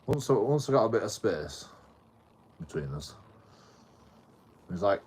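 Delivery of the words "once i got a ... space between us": ordinary delivery, nothing marked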